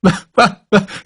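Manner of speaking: short coughing bark of a laugh; odd barking chuckle. A narrator's reading. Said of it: "the Major was laughing".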